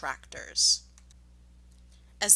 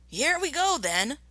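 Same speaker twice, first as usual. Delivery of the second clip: much higher, eager cry; shouted; 9 semitones higher than usual. Acted. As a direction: call out as the attack starts. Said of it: "shouted Bean".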